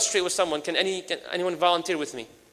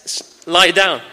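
louder commanding instruction to the volunteer; 8 dB louder, much slower than usual. Acted. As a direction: tell him firmly to lie down. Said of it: "then the Prophet says".

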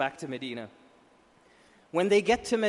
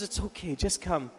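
soft, lowered coaxing; gentle reassurance; 3 semitones lower, 6 dB quieter than usual. Retold or imitated. The Prophet invites the boy to come, gently. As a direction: coax a hesitant child quietly.

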